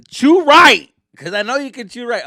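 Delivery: loud, high yell; shouting at her. Real, not acted. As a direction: snap at her to chew properly. Yelling at girlfriend over popcorn.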